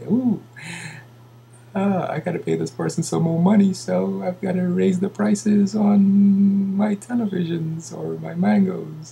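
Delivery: high-pitched mock seller, musing aloud; put-on seller voice. Acts seller deciding to raise prices.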